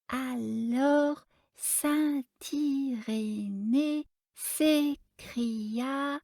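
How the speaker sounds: put-on high, very slow child's voice, stopping on every syllable; a little orphan girl spelling out her reading lesson. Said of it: "qui lisait en s'arrêtant à chaque syllabe". (lecture d'enfant, très lente et haute, syllabe par syllabe)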